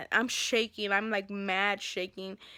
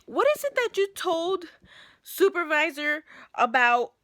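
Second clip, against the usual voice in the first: raised, higher, louder demand; confronting dispatcher; 4 semitones higher, 6 dB louder than usual. Retold or imitated. To the dispatcher, a raised angry demand. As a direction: storm in and demand answers. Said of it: "I storm in there".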